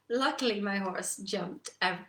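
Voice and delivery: ordinary delivery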